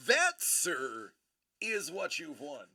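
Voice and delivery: usual speaking voice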